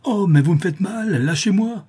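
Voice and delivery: high, loud yelp of protest; urgent, pained plea to let go; pained cry. A narrator's reading. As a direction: cry out as if your wrist is crushed. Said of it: "et s'écria".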